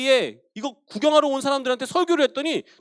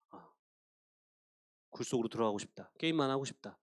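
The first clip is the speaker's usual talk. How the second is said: much lower, softer defeated murmur; despairing inner voice; 9 semitones lower, 9 dB quieter than usual. Acted. Discouraged believer thinking aloud, low despairing murmur. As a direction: let the heart collapse, giving up. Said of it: "이런 마음이 생기는 거죠".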